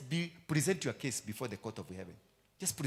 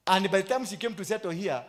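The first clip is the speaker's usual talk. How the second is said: rising preaching; 7 semitones higher, 8 dB louder than usual. Acted. Preacher builds up.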